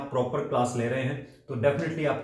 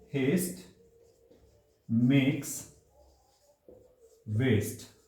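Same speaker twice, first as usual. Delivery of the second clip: each word alone with long pauses; slow dictation; 2 semitones lower, much slower than usual. Shown as a demonstration. Teacher dictating the proverb word by word to students. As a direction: spell it out slowly for the notebook. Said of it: "आप इसको अपनी notebook में भी लिख सकते हैं".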